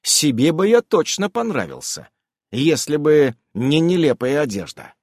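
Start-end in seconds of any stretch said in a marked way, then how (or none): none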